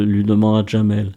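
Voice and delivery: ordinary delivery, nothing marked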